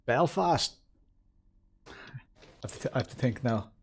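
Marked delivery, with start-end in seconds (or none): 0.1-0.8 put-on drawn-out Belfast accent, pitched up. Streamer trying out a requested accent, Belfast accent. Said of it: "I do an accent".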